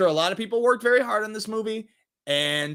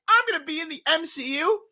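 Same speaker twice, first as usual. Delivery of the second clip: a much higher, thrilled squeal of joy; giddy excitement; 11 semitones higher, faster than usual. Retold or imitated. Mocking the actress when the Marvel offer came, giddy high squeal. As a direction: gush like a starstruck fan. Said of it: "She's like".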